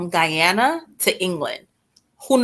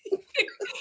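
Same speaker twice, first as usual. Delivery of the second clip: soft laugh; 14 semitones higher, 10 dB quieter than usual. Real, not acted. Laugh after punchline.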